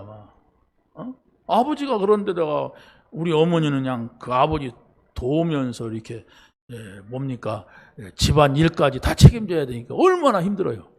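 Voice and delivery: ordinary talk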